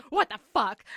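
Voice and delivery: very loud, punched exclamation; outraged disbelief. Retold or imitated. Reader reacting to his one-word text, outraged. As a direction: explode on each word. Her own retold outburst at text.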